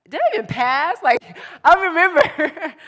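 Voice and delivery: high, loud, laughing disbelief; laughing self-mockery. Real, not acted. Speaker joking to youth about her own class, laughing self-mockery. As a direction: laugh at yourself, voice shooting up.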